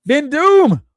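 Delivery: big high-pitched holler; shouted greeting. Real, not acted. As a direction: belt out the name like an announcer. Hailing a viewer by name.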